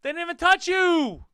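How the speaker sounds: high, loud yell of disbelief; outraged high-pitched holler; indignant shout. Real, not acted. Yells at person in the clip.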